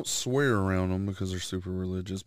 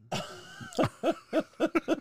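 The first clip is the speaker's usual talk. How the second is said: high teasing. Real, not acted. Teasing punchline.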